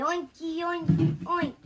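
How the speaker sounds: high and loud gleeful oinking; loud joyful oinks. Acted. Pig cheering Gerald up, shouting with glee. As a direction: crow it out happily.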